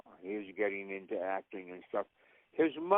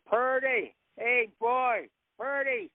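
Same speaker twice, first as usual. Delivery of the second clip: calling out; 13 semitones higher, 4 dB louder, slower than usual. Acted. Calling dog down the hill.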